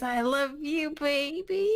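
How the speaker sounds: high, put-on cooing; doting fake mother. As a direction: coo it high, rising at the end. [name] fakes love for baby.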